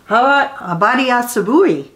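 usual speaking voice

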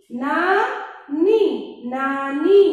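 नानी drawn out slowly, then नानी said briskly; slow then normal. Shown as a demonstration. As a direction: stretch नानी, then say it plainly. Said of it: "इसके हम शब्द भी बता देते हैं".

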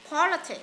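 "politic" with PO high and strong; stress on first syllable. Shown as a demonstration. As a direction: hit the opening of politic. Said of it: "Politic nhấn âm đầu này".

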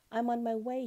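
high, loud butterfly call; lightly acted cheerful departure cry. A narrator's reading. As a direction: call out as you flutter off. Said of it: "he cried".

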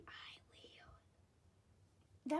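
sneaky whispered I will; whispered puppet line. Pretend. Banana puppet cutting in with a whisper. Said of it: "That banana is strange".

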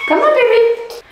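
put-on high coaxing baby talk. Mom coaxing her baby.

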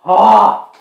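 sharp loud cry of pain; pained yelp. Real, not acted. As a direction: yelp as the heat hits. First bite of hot wing.

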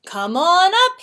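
high, loud beckoning call. A narrator's reading. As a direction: call friends aboard. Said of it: "he called to Monkey and Sheep".

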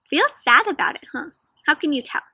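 normal talking voice

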